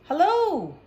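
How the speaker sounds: bright, high, loud greeting; cheery hello. Acted. Mother calling up to the handyman on the ladder, cheerful.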